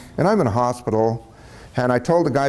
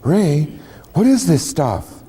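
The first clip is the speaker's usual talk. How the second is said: tiny little voice; 3 semitones higher, slower than usual. Retold or imitated. Caller asking Ray about the box, tiny little voice. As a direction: ask it in a lightly voiced tiny voice. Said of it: "in this tiny little voice".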